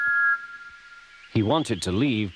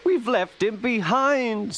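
much higher, slow mock sob; sobbing; 12 semitones higher, slower than usual. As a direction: wail it like tearful little coaches. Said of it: "sobbed Annie and Clarabel".

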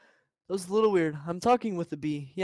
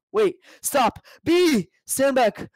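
sudden loud higher yelp of alarm; alarmed shout; 6 semitones higher, 5 dB louder, much slower than usual. Acted. Vanessa warning her friend about a bee, loud alarmed shout.

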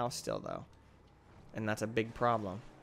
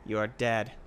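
normal talking voice

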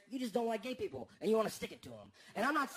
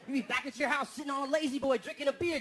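ordinary talk, nothing marked

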